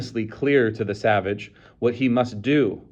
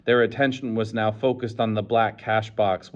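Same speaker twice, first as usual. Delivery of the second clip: usual speaking voice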